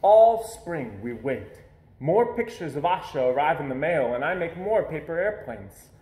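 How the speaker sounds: ordinary delivery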